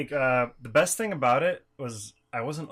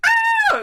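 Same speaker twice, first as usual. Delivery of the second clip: high, loud happy shriek; mock child's excited scream; imitated kid squeal; 11 dB louder than usual. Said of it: "the kid would be like".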